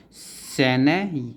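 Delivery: teacher spelling out सैन्य slowly for students; slow drawn-out syllables. Shown as a demonstration. Teacher drawing out सैन्य slowly for the class. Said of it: "सैन्य यत".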